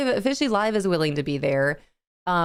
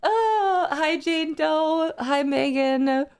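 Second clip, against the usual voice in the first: slow, high, teary hellos; choked up while greeting friends; tearful greeting; 9 semitones higher, much slower than usual. Real, not acted. Streamer greets chat through happy tears, teary. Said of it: "Oh my gosh, I'm crying".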